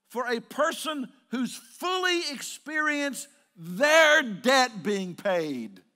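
high, shouted exhortation, stretched at the peaks; full-force preaching. Real, not acted. Preacher telling folks forgiveness is everyday business, shouting. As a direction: drive it home to the congregation at full volume.

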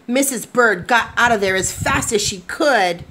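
gentle, expressive storybook narration; lively picture-book reading to kids; reading to children. A narrator's reading. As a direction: read it like bedtime story time. Reads picture book aloud.

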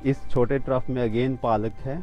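ordinary talk

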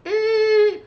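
high thin "eee" sound effect; drawn-out high squeal; imitated plane whine. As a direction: mimic a plane losing height. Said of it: "Like, it's like".

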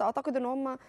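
usual speaking voice

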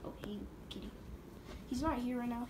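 ordinary talk

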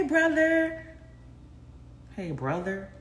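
normal talking voice

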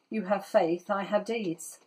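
ordinary delivery

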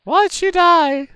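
put-on high, slow child's question; small child. Child asking a friend why her mother died. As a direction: ask innocently like a little kid.

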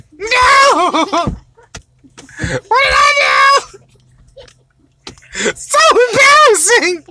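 fake-sob the no's over a lost game; mock crying. Dad losing a game to his kid, hammed-up fake crying.

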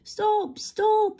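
very high, piping cry to stop; whistled cry. A narrator's reading. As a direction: pipe it like a train whistle. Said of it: "he whistled".

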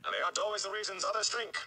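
hurried shout across the room. A narrator's reading. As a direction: shout it after him as he runs out the door. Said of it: "The last was a shout".

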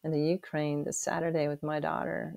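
ordinary delivery

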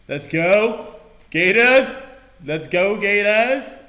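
loud rising team chant; rally the crowd for the Gators; excited cheer. Real, not acted.

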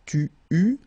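"tu, u" with two French u vowels, no S; silent final S. Shown as a demonstration. Teacher modelling a verb form, silent final S. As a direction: say "tu, u" clearly, ending on the vowel. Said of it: "final S not pronounced".

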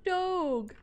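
loud, high delighted shout; excited shout. Real, not acted. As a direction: yell it out in pure delight. Spots a dog in game.

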